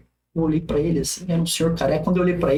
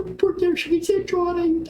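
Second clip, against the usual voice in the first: choked-up voice; 11 semitones higher than usual. Retold or imitated. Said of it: "Minha voz não saía".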